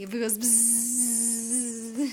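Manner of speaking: buzzing bee sound. Pretend. Dad imitates bee.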